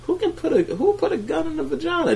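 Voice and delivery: very high-pitched puzzled question; mock bafflement. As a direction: squeak it out in disbelief. Quotes own baffled reaction.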